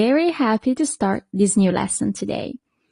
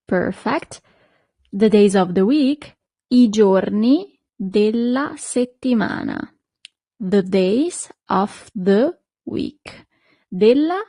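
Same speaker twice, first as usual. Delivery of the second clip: ordinary talk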